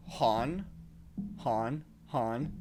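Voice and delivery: "Han" for John, J turned to H; Spanish-accented pronunciation. Retold or imitated. Coworkers saying his name, John pronounced "Han". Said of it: "they kept saying my name".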